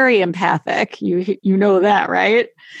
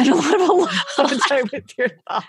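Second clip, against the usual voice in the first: laughing; 4 semitones higher than usual. Real, not acted. Laughing about alone time.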